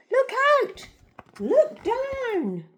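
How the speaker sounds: high, loud warning cry; urgent storybook yell. A narrator's reading. Narrator voices Drip facing the tyrannosaurs, yelling. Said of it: "who yelled".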